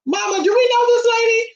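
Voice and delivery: high mocking little kid voice; child's voice. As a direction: squeak it like a bratty child asking mama. Child's voice quoted in story.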